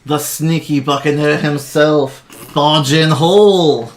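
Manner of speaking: slow, loud stretched name call; milk the name for the crowd; arena announcer. Acted. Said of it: "And he goes".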